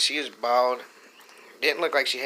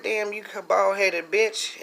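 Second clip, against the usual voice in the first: insult; 7 semitones higher than usual. Retold or imitated. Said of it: "So I said".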